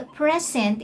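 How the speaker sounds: "present" stressed on PRE; "present" as in a gift, PREZ-ent; stress on first syllable. Shown as a demonstration. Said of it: "take note of the stress".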